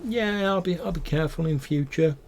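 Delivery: high, meek goblin promising; crestfallen goblin. Acted. Scolded goblin promising guards to behave, high and meek. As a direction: promise sulkily in a high small voice. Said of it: "and he's like".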